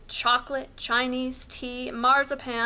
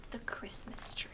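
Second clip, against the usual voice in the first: hushed, breathy whisper; 16 dB quieter than usual. A narrator's reading. Whispering to a child at bedtime. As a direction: whisper it like a secret.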